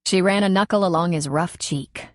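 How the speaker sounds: plain reading voice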